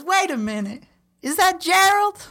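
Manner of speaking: high, loud surprised yell; gnome yelling. Acted. Gnome on the troll spots Gerald, yelling high and surprised. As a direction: shout up in delight at spotting him. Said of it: "He says".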